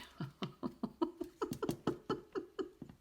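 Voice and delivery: long high laugh; real laughing fit. Real, not acted. Reader cracking up at the lyric on the card, helpless laughter. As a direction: burst into breathy, high-pitched giggles. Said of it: "I'm laughing".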